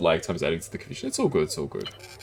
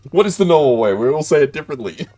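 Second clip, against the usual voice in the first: laughing; 8 semitones higher, 9 dB louder than usual. Real, not acted. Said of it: "laughing protest at pronunciation".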